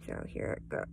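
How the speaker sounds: hushed whisper before reading. Real, not acted. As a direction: breathe it out softly. Starting the poem reading.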